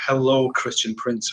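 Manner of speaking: normal talking voice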